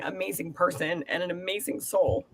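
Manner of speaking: ordinary delivery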